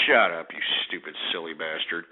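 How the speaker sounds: low, weary put-down; dismissive insult. Real, not acted. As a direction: drop the voice right down, unimpressed. Host dismissing a prank caller.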